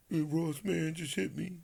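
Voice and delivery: lightly voiced whiny Floyd; mocking Floyd. Mocking Floyd calling Ross at three in the morning. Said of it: "that's how you talk".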